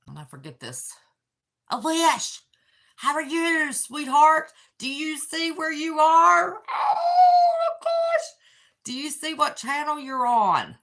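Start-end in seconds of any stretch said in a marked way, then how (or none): 1.7-6.6 high and loud, calling out to a loved one; gushing hello to her son; excited greeting. Real, not acted. 6.7-8.3 very high and loud, laughing through tears; tearful laughing oh gosh; laugh-crying. Real, not acted. Overwhelmed seeing him on channel.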